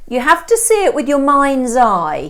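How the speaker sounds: pushy estate agent. Acted. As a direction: sell the wreck like a dream home. Estate agent Stone voiced.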